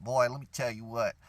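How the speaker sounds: normal talking voice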